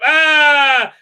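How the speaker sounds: loud, high "aah" yell; mock startled scream. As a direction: yell out a hammed-up wake-up scream. Bullhorn wake-up in retold scene.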